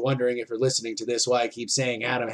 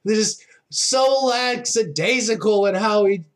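steady live play-by-play calling; wrestling commentator; 6 semitones higher than usual. Real, not acted. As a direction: call each move as it lands.

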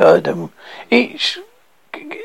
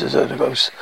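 ordinary delivery, nothing marked

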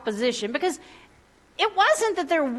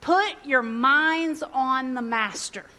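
slow reading; 2 semitones lower, slower than usual. Shown as a demonstration. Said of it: "the Message put it this way".

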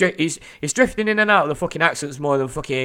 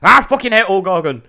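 louder, higher pretend rant; mock angry outburst; 6 semitones higher, 6 dB louder than usual. Podcaster pretending to storm off air, mock angry. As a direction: burst out in mock fury.

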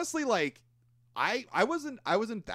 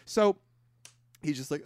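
normal talking voice

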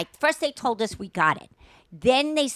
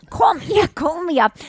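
much higher and louder, insistent; loud demand; 8 semitones higher, 6 dB louder than usual. Retold or imitated. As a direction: bark it like an exasperated demand. Retelling demand to be called.

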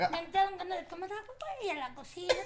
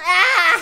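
very loud fake moan; hammed-up moan, very loud; mock moaning; 18 dB louder than usual. Mom's moans in sex anecdote.

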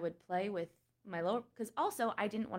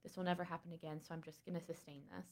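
quoted private thought; 2 semitones lower, 12 dB quieter, much faster than usual. Retold or imitated. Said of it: "I was like".

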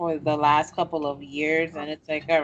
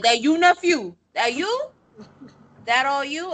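loud, high, leering uncle at a cookout; booming tipsy uncle; 6 semitones higher, 4 dB louder than usual. Retold or imitated. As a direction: call it out like the uncle sizing up your date. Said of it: "and he be like".

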